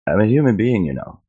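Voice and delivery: retold protest, pitch rising and falling; exasperated appeal, lightly acted; indignant plea. Retold plea to the dismissive teller.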